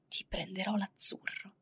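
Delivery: slow, soft whisper; whispered promise. Retold or imitated. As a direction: breathe the promise slowly, like a secret. Lover's promise, quoted.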